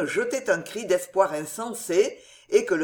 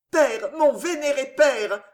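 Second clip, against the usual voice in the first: higher, imploring plea; pleading daughter; 7 semitones higher, 4 dB louder than usual. A narrator's reading. Daughter imploring her dying father, higher pleading voice. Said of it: "supplia Jeanne".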